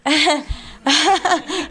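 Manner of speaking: embarrassed laugh. Real, not acted. Laughing at her own slip on air. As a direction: burst of high embarrassed laughter.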